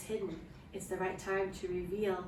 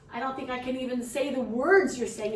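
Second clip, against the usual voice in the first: louder, higher-pitched refusal; distressed protest; 4 semitones higher, 6 dB louder than usual. Acted. Mother asked to tell her husband, protesting loudly. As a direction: push back at the doctor, raising pitch and volume.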